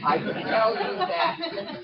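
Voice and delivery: high laugh through the words; chuckling while warning. Real, not acted. Warning about redheads, laughing.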